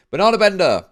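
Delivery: donor name belted out high and loud; loud callout. Real, not acted. Streamer announcing a donor's name, loud excited callout. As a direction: streamer hollers the donor's name across chat.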